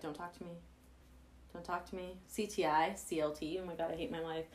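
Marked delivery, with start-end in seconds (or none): 0.0-2.2 quiet. Retold or imitated.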